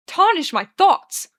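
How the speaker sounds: loud, high, slow and indignant; spat out indignantly. A narrator's reading. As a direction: spit each word out in outrage. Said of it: "Arthur spits".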